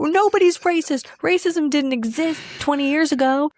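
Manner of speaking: high-pitched mocking protest; mock denial. Acts out people denying racism.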